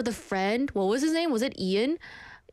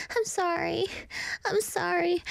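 put-on higher, sweetly apologetic girlfriend; Nikki; 6 semitones higher than usual. Toxic girlfriend apologizing to her boyfriend, high and pleading. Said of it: "I can do a good impression of Nikki from Obsession".

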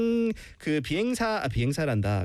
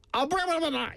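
fast, higher-pitched gibberish imitation; mock garbled reading; 11 semitones higher than usual. Teacher imitates student's botched reading, mock garbled gabble. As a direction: rattle off "아브라바라바라" as a mangled word. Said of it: "이렇게 읽었어요".

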